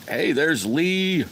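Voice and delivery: high, loud, drawn-out hello to a newcomer; excited greeting. Real, not acted. Streamer spotting a viewer in chat, greets him with a loud, high call. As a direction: call out happily to a viewer who just arrived.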